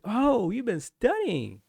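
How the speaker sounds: high, slow, sing-song approval; impressed drawl. Retold or imitated. Instructors react to the studious trainee in a high, slow, impressed drawl. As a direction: coo it like a pleased superior.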